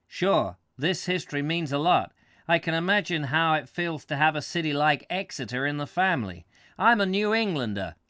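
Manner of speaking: put-on light New England American accent, lively. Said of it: "that's a New Englander accent".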